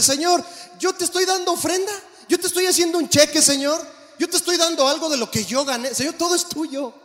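high, earnest plea of a humble king; David pleading in prayer. Acted. Preacher voicing King David offering his gifts to God, high and eager.

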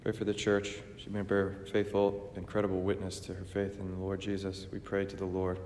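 ordinary talk, nothing marked

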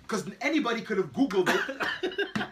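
laughing. Real, not acted. Laughing while making his point.

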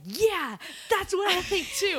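high, excited agreement; hype woman. Retold or imitated. Telling a friend how she echoes her, hyped-up agreement. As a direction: pitch it up like an eager cheerleader. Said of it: "You're my hype woman".

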